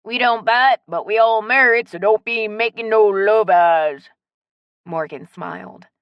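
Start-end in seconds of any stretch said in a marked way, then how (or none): 0.0-4.1 playful Cajun warning, words stretched; strong Cajun accent. A narrator's reading. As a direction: tease in broad Cajun. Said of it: "in his strong Cajun accent".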